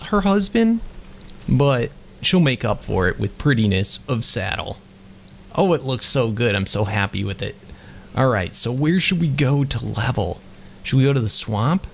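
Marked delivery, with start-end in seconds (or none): none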